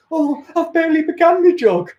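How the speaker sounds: high put-on voice, playing innocent; sheepish jogger. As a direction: fob him off in a silly voice. Acted jogger stopped by officer.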